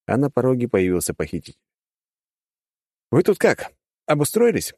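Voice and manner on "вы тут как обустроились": cheerful mocking young man; smiling host voice; smiling captor. A narrator's reading. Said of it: "улыбнулся парень".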